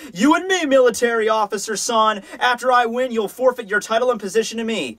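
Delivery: high, hammed-up challenge; cocky challenger. Boy challenging a military officer for his title, high mock bravado. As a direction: throw down a duel, starting very high. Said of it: "he looks over and he's like".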